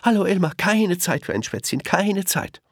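very high quick fly-by greeting; chirpy birds. A narrator's reading. Birds zip over Elmar, very high chirpy brush-off. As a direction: chirp it as you zip past.

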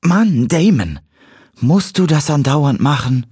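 startled, high-pitched complaint; exasperated groan. A narrator's reading. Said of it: "stöhnte sie". (genervtes, hohes Stöhnen)

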